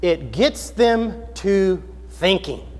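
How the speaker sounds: put-on very slow, spaced-out words in own accent; slow pondering. Preacher reflecting on his translator's words, slow pondering. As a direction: weigh each word slowly and thoughtfully. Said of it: "I thought long and hard about that".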